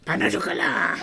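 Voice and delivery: hammed-up French pan au chocolat; teasing pretend-French; mock French accent. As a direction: stretch the last vowel, stagey French accent. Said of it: "in a French accent".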